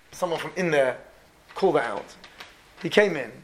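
usual speaking voice

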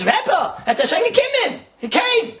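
high, breathless servant's cry; excited announcement. Retold or imitated. Servant bursting in to tell the Rebbe, high and excited. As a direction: burst in with the news.

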